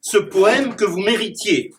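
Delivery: declaimed rhyming verse with punchy rhyme endings; poem recitation. Acted. Clown reciting his rhyming poem to the audience. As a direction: perform the poem for the audience, landing each rhyme.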